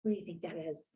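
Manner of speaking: soft hushed question. Real, not acted. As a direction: ask it in a hush.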